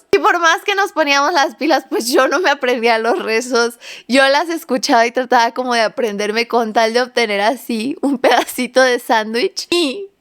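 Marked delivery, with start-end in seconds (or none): none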